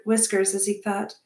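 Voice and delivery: ordinary delivery, nothing marked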